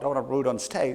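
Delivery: usual speaking voice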